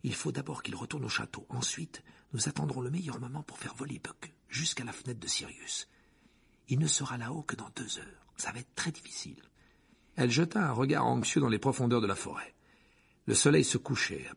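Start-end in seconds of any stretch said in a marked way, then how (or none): 0.0-9.4 soft, hushed planning; shaken. A narrator's reading. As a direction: explain it in a worried near-whisper. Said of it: "dit Hermione visiblement bouleversée". (tout bas, inquiète)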